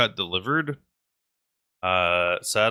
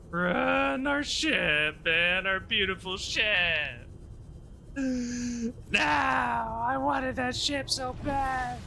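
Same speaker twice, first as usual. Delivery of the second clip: mock wail; 12 semitones higher, much slower than usual. Real, not acted. Mourning lost ship.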